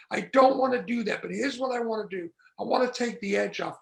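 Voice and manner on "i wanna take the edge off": normal talking voice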